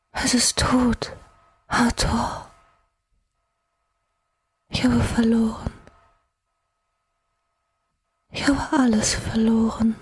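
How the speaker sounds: high, whispered, slow despair; voice a breath in the wind. Acted. Exhausted goddess whispering her despair to Hathor. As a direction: barely breathe the words of loss. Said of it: "Ihre Stimme war nur ein Hauch im Wind".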